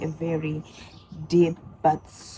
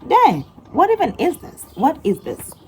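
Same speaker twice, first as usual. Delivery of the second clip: high, loud, incredulous reaction; baffled disbelief; 6 semitones higher, 4 dB louder than usual. Retold or imitated. Vlogger reacting in shock to the TikTok, loud and high. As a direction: squeal it like you can't believe it.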